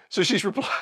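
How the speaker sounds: ordinary talk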